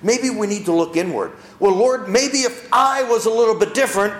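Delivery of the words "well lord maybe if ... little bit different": higher, louder pleading prayer voice; mock humble prayer. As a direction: act out a believer looking inward.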